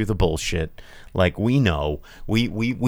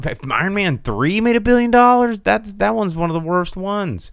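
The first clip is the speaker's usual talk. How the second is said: indignant; 9 semitones higher than usual. Real, not acted. Indignant at box office.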